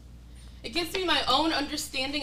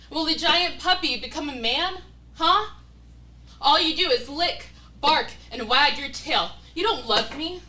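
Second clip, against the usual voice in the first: loud, mocking jabs at him; angry taunting; 7 dB louder than usual. Woman taunting her partner about the pregnancy, loud and scornful. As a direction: taunt him hard and loud, like he's a dog.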